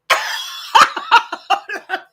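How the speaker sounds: loud, high, breathy burst of laughter; big laugh. Real, not acted. Grandmother cracking up at her granddaughter's answer, big laugh.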